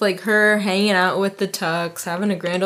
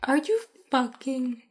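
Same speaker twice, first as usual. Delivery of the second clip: mock outrage; 4 semitones higher, 4 dB louder than usual. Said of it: "she's probably like".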